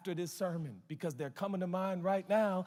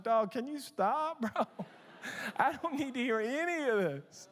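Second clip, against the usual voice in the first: laughing protest; 6 semitones higher than usual. Retold or imitated. Pastor to young man confessing hatred, laughing plea. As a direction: laugh while begging him to stop. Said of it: "And I was like".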